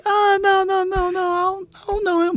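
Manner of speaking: high-pitched flustered mom impression. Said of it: "She's like".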